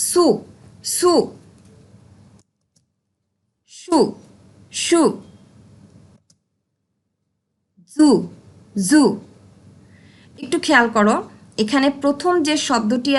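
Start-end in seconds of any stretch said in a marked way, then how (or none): 0.1-9.0 সু with a sharp hissing s, জু with a buzzing z; sound drill. Shown as a demonstration. Teacher drilling s and z sounds with the class. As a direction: stretch the hiss in সু and the buzz in জু. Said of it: "pronounce with me".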